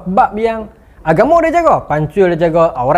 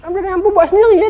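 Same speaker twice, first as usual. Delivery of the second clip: much higher, fast mock student; 13 semitones higher, faster than usual. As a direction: rattle it off in a mocking high voice. Pelajar perempuan marah pensyarah. (ejekan suara pelajar, tinggi dan laju)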